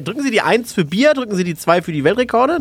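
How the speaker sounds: high automated phone menu announcement. Retold or imitated. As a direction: read it like a recorded hotline menu. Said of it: "rufst du an".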